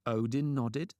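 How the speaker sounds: usual speaking voice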